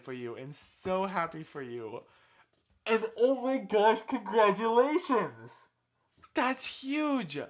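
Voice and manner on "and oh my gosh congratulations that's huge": high, loud, drawn-out joy; excited congratulations. Pretend. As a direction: burst out with delight. Rehearsing congratulations again.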